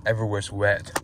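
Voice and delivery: ordinary talk, nothing marked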